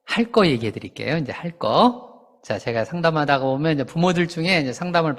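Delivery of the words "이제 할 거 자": ordinary delivery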